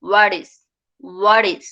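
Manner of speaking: what is run together as "waris"; low, falling what is with a tapped T; linked T. Shown as a demonstration. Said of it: "টিটা আমার চলে যাবে".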